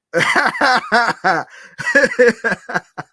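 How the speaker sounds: high, loud burst of laughter; laughing hard. Real, not acted. Host cracking up over Dunkin' bloopers, laughing hard. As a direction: crack up in a long real laugh.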